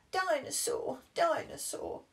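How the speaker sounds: put-on high falling toddler call; high babyish chant; toddler cry. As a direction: demand your dinosaur toy. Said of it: "cried George".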